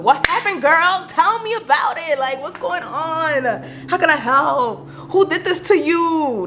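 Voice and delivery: high, eager and slow, all attention for a friend in need; eager concerned friend. Retold or imitated. To a friend who calls crying, high and eager concern. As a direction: play the friend who drops everything to listen. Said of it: "when they call me, I'm gonna drop what I'm doing".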